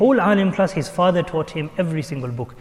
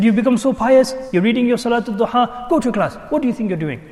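raised, higher-pitched scolding; stern shouting; 7 semitones higher, 4 dB louder than usual. Retold or imitated. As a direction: imitate a father sternly telling off his son. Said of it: "he shouted at me so sternly".

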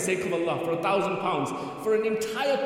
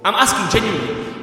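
ordinary talk

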